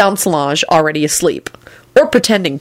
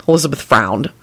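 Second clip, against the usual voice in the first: usual speaking voice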